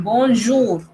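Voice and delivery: "bonjour" with zh-like J and nasal "on"; French J sound. Shown as a demonstration. Teacher giving an example of J, French J sound. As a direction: say "bonjour" as French "bon-zhoor". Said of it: "suena como una Y".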